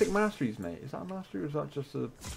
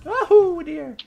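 sudden high yelp of alarm, much louder; a loud startled cry at a bad moment; alarmed shout; 14 dB louder than usual. Real, not acted. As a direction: blurt it out in shock. Sudden alarm during a fight.

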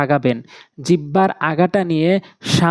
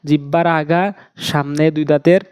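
usual speaking voice

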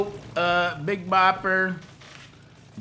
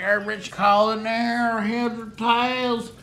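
higher, slow, drawn-out call; pilot calling coin toss; 7 semitones higher, 3 dB louder, slower than usual. Retold or imitated. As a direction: stretch the call like a game host.

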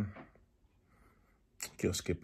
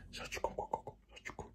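very soft, luring whisper; creepy whisper; 16 dB quieter than usual. Retold or imitated. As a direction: whisper it as a prank from the dark. Re-enacts creepy joke in the dark. (griezelig lokkend gefluister)

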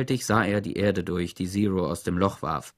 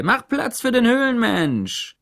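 hearty shout; 13 semitones higher, 5 dB louder than usual. A narrator's reading. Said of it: "sagte X-Ray".

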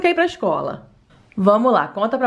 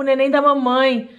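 normal talking voice

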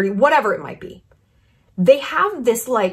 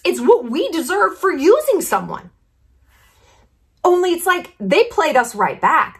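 higher excited rant; 8 semitones higher than usual. Real, not acted. Animated rant peak.

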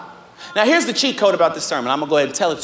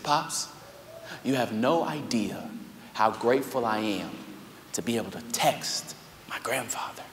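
tender; 8 semitones lower, 8 dB quieter than usual. Retold or imitated. Tender words to grieving grandfather.

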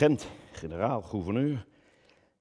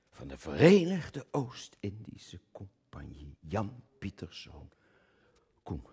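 slow, unvoiced reverent whisper; hushed whisper; 5 dB quieter than usual. Actor hushing the name of the governor-general, whispered. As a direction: breathe the name like a secret.

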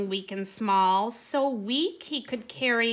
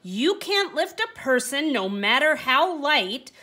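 loud, high, scornful scolding; angry dismissal; 4 semitones higher, 6 dB louder than usual. Acted. Mean owner chasing the donkey out of his sight, loud angry scolding. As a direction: drive the useless donkey off with contempt. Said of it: "said with a huff".

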